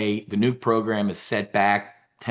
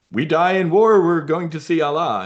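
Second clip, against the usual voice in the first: mock war cry, louder and higher; lightly hammed-up fervour, raised voice; mock zealot; 6 semitones higher, 5 dB louder than usual. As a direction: imitate an eager martyr, pitched up. Voices Iran's zealous war attitude.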